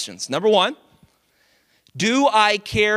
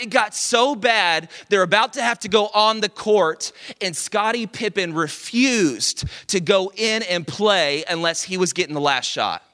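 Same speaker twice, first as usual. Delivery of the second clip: normal talking voice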